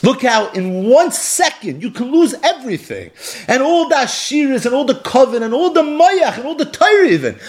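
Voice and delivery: high, urgent sermon cry; impassioned preaching. Acted. Preacher warns the crowd how fragile life is, impassioned.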